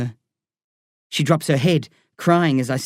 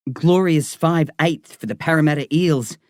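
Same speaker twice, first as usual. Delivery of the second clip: plain reading voice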